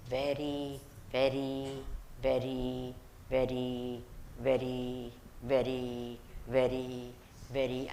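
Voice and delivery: "very" repeated in a low, even voice with a break after each one; emphatic repetition. Real, not acted. Teacher getting it into the students' heads, emphatic low repetition. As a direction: hammer "very" in softly, low and measured, one at a time. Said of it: "I'm emphasizing it for".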